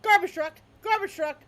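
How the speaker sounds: loud, high, repeated barky bursts; bulldog barking alarm. Acted. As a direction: bark out the alarm like an agitated bulldog. Bulldog barks an urgent alarm.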